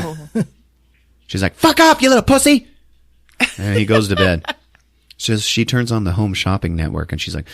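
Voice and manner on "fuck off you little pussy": high, loud acted curse; angry yell. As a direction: shriek the insult in mock fury. Character curses at another.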